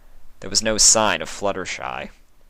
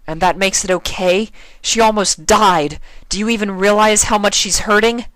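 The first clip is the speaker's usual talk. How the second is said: much higher, louder accusing; angry outrage; 13 semitones higher, 7 dB louder than usual. A narrator's reading. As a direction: shout at the monster. Twilight furious at changeling.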